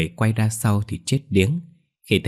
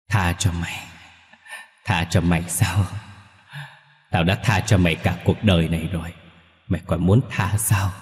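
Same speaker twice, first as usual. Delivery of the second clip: scornful ghost father. A narrator's reading. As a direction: throw the plea back with bitter scorn. Ghost father scorns son's plea.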